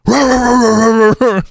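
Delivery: high, loud, nonstop dog bark; rapid high-pitched mock barking; imitated dog barking. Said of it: "the dog would start barking".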